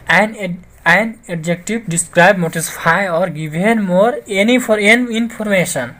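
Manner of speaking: very slow, spaced words; slow reading of a definition; slow dictation. Shown as a demonstration. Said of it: "teacher reads definition aloud".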